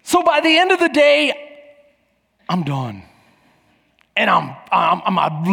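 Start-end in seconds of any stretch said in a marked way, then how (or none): none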